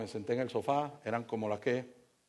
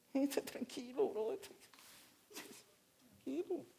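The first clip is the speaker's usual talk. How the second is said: high, hushed calming voice; soft calming; 10 semitones higher, 12 dB quieter, slower than usual. Retold or imitated. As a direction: soothe the drunk gently, almost whispering. Calming an angry drunk. (tono suave y calmante)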